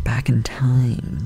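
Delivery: slow, whispered and wistful; slow dreamy whisper about the past; nostalgic whisper. Real, not acted. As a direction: breathe it like a fond memory. Dreamy nostalgia for childhood meals.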